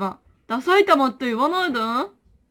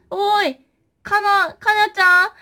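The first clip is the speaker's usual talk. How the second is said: higher calling voice; 6 semitones higher, 4 dB louder, slower than usual. Acted. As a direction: call out across the room. Calling out for the plush. (高めの声で呼びかける)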